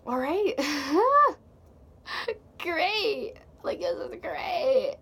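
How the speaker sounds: high, loud fake enthusiasm; sarcastic cheer. Putting up with the driver's playlist, sarcastically cheery. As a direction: force out mock delight.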